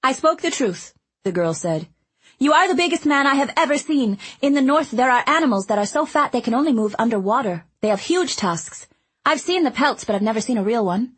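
put-on high, eager chatty girl; bubbly little girl rushing her words; young girl voice. A narrator's reading. Girl rattles on fast.

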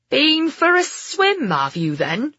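slow, high-swooping Somerset landlord; strong Somerset accent. A narrator's reading. Landlord greets soaked travellers, strong Somerset accent. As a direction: tease the newcomers in broad Somerset. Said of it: "asked in a strong Somerset accent".

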